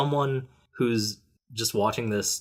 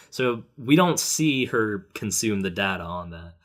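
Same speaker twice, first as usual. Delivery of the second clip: ordinary talk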